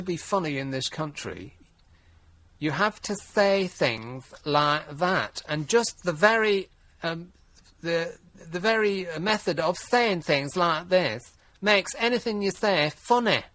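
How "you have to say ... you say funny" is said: lightly hammed-up camp drawl, slow; a hint of a camp TV host, mocking. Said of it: "That was more Lily Savage you were doing there".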